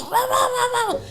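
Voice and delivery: very high playful bark sounds, laughing; laughing mock dog barks; imitated barking. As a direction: yap like a pack of little dogs. Imitating dogs barking at gates.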